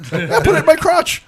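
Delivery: loud, very high granny squawk; hammed-up German granny, high and loud; old German lady. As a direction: holler back as an old German woman. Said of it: "when the old German lady's like".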